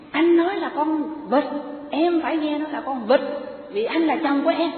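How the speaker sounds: husband insists he is right because he is the husband, in a high mock yell. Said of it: "ông hét lên nói".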